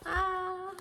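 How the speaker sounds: high sustained "ah"; delighted squeal. Real, not acted. Vlogger thrilled over her kits, high squeal.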